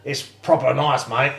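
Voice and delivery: mock Australian accent with laughter breaking in; Hillary. Co-host impersonates the butcher selling lamb, playful Australian accent. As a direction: play a matey Aussie butcher. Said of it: "let me do my Hillary".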